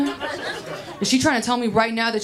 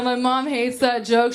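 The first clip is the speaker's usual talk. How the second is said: ordinary delivery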